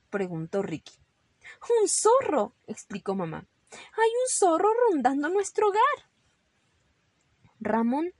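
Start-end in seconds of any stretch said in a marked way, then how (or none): 1.8-2.5 high, loud announcement of danger; alarmed mother. Acted. Mother warning children of fox. 3.7-5.8 high, urgent mother's warning; alarmed warning. Acted. Mother warning children of danger.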